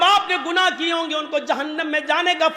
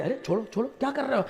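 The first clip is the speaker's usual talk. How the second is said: much softer, lower coaxing plea; hushed angels; 5 semitones lower, 10 dB quieter, much faster than usual. A narrator's reading. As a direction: gently tell them to let go. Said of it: "فرشتے کہیں گے".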